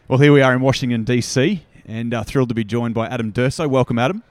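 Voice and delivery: bright energetic podcast host greeting a guest; interview host intro. Acted. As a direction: open the interview like an on-air host. Host opens recorded interview.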